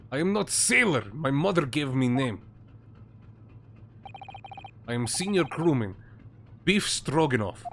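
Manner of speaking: put-on proud, stiff broken-English Russian accent; Russian-accented sailor. Sailor correcting the lawyer, proud Russian accent. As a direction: insist on his dignity in a Russian accent.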